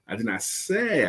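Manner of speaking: single out "say"; stress on SAY. Shown as a demonstration. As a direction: draw out and lean on "say". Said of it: "I could have implied it".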